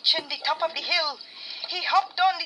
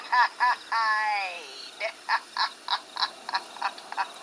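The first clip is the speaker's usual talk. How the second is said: a mocking ghostly chuckle; eerie ghost laugh; 4 semitones lower than usual. A narrator's reading. Ghost rider laughing at Mister Kwashi, eerie chuckle. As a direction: laugh low at the fleeing man.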